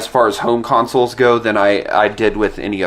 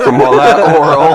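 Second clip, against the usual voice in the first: laughing; 5 semitones higher, 6 dB louder than usual. Real, not acted. Laughing at innuendo.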